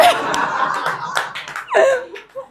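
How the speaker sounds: high-pitched burst of laughter. Real, not acted. Laughs recalling the scare.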